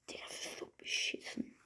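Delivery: unvoiced frustrated whisper. Real, not acted. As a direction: whisper it in disgust.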